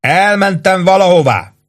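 high shouted retort; calling back. A narrator's reading. Sergeant calling back to the chief. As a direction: call back with a wink.

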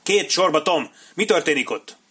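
urgent high, loud commands; yelled orders. A narrator's reading. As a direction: shout to hurry the men. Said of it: "üvöltötte Sharp".

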